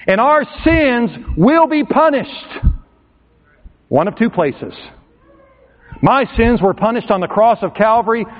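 fiery sermon climax; preaching at full force. Acted. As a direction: build it up and preach hard.

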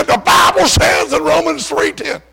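high, loud pulpit shout; preacher shouting scripture at the bartender; preaching shout. Retold or imitated. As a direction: slam it down like scripture in a bar. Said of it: "He said".

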